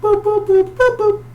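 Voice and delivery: very high dialing boops; short falsetto keypad tones; imitated phone beeps.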